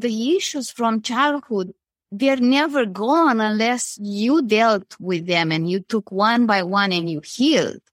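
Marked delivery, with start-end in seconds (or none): none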